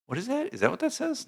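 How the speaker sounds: high, baffled squint at the words; puzzled surprise. Real, not acted. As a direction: sound genuinely thrown by what you read. Puzzling over odd tweet text.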